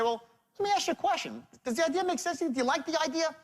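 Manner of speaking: high-pitched, quick, disarming question; money-aside tone. Shown as a demonstration. Salesman answers "let me think about it", high and disarming. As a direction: lift the voice right up to wave the issue away. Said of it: "I push the money aside with my tone of voice".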